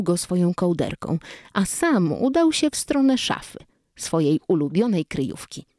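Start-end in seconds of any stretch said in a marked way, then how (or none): none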